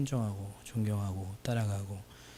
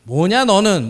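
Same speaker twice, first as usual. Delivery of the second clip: louder, higher snap; gruff shout; 6 semitones higher, 11 dB louder, slower than usual. Retold or imitated. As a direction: demand it like a cranky professor. Professor snapping at student. (퉁명스러운 고함)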